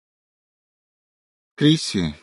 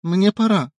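gentle farewell; 9 semitones higher than usual. A narrator's reading. Wife saying goodbye.

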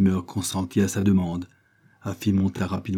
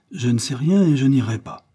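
raised, louder flat-out refusal; defiant refusal; 6 semitones higher, 5 dB louder than usual. A narrator's reading. Afi refusing Ebenezer's summons, defiant and insistent.

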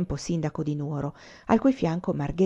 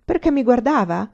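higher, louder comeback; half irritated, half cheerful; 6 semitones higher, 6 dB louder than usual. A narrator's reading. Boy answering about the girl's glances, half irritated, half cheerful. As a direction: retort lightly, a little nettled. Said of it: "un po' irritato, un po' allegro".

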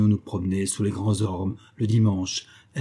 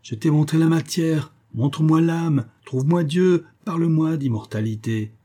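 exalted challenge; 6 semitones higher than usual. Acted. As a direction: hurl the challenge at him. Professor defies Hermann, exalted.

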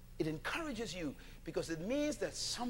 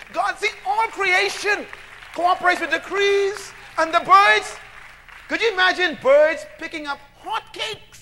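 loud, rising call to the congregation; full-force preaching; 13 semitones higher, 16 dB louder than usual. Acted. Preacher calling out to his congregation, full-force preaching.

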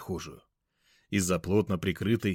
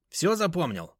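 friend's voice; 9 semitones higher than usual. Acted. Gruff friend Venik speaks.

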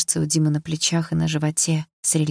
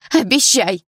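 louder, insistent demand; stubborn demand; 5 semitones higher, 6 dB louder, slower than usual. A narrator's reading. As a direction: dig in and demand it. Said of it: "упрямо сказала я".

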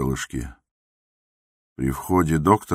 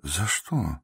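soft, quiet, puzzled question; gently; 2 semitones higher, 6 dB quieter than usual. A narrator's reading. The doctor asks the raging patient why, softly. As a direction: ask it mildly, almost under your breath. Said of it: "спросил мягко".

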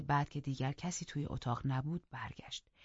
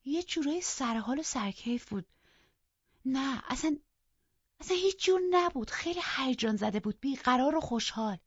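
rising higher and louder with excitement, up to a near cry; building from bright to breathless eager exclamation; excited telling; 10 semitones higher, 5 dB louder than usual. Acted. Young girl eagerly telling the housekeeper about the master, excited. Catherine describing master's strange mood.

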